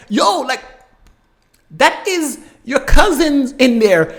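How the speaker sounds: high, loud, drawn-out disbelief; appalled protest. Retold or imitated. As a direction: call out in shock, stretching the words. Acts out appalled reaction to beating.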